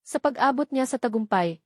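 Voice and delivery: ordinary delivery, nothing marked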